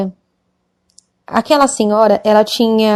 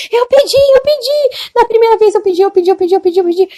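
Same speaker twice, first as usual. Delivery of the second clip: put-on insistent young woman; 10 semitones higher, 4 dB louder than usual. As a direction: keep repeating it, high and desperate. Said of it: "Ela falou".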